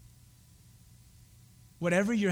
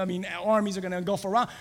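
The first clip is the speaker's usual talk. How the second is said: ordinary talk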